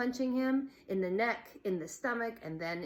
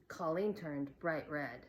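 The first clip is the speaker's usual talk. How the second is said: ordinary delivery, nothing marked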